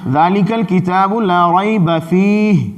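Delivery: slow recited "ذالک الکتاب لا ریب فیہ", stopping after "فیہ"; Quranic recitation with a stop. Shown as a demonstration. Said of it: "یہ وقف کیا جائے".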